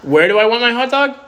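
high, loud question across the room; calling out. Real, not acted. Streamer calling to partner about her hot dog, loud. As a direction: call it out to someone away.

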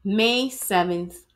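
plain reading voice

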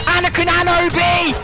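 high, strained-out yell of names; exasperated shout. Real, not acted. Gamer calling out who killed him, exasperated shout.

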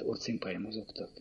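ordinary delivery

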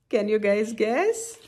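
ordinary delivery, nothing marked

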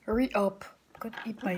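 urgent whisper. Real, not acted. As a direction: whisper it, hurry them along. Whispers urging friend.

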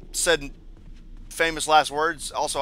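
normal talking voice

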